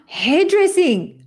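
usual speaking voice

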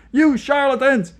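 high, loud furious scream; outraged prisoner screaming at interrogators. Acted. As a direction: scream it at them through the glass. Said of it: "he screamed into the microphone".

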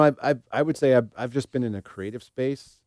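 ordinary talk, nothing marked

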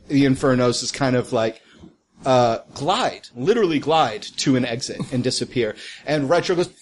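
normal talking voice

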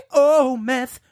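ordinary talk, nothing marked

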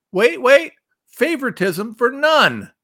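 high, loud, then slowed on the words; indignant stress on favoritism; excited emphasis. Real, not acted. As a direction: pounce on the phrase. Seizing on quote phrase.

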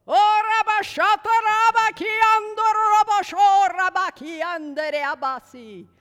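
high, very loud re-enacted prayer in tongues; imitating an explosive burst of ecstatic prayer; outburst in tongues. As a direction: belt out a sudden rush of tongues.

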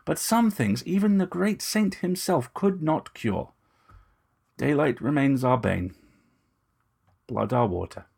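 lightly voiced vampire gentleman, grand and bitter; a vampire lecturing on his kind's history; brooding aristocratic vampire monologue; Moust, proud vampire. Moust's speech about vampires.

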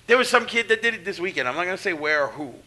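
ordinary talk, nothing marked

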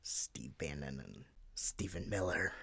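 hushed, breathy aside of two names; whisper. Real, not acted. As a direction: whisper it like a secret tip. Aside naming the culprits.

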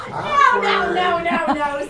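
loud protest. Acted. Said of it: "said the carrot".

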